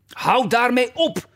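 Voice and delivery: high, drawn-out exasperated cry; screamed order. A narrator's reading. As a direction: stretch the command out, very high. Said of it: "schreeuwde de koningin". (hoge, langgerekte geërgerde uitroep)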